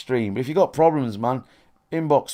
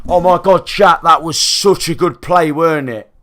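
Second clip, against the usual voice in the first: fake hype; 4 semitones higher, 9 dB louder than usual. Pretending eight viewers are a thousand: loud, high gushing. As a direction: gush to an imaginary big audience. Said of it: "pretending I'm streaming to a thousand".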